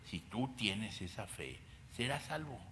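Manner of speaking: ordinary delivery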